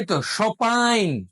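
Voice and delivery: "সপাইন" drawn out slowly as shɔpain, no m; slow French-style "সপাইন"; slow model word. Shown as a demonstration. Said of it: "কি হবে".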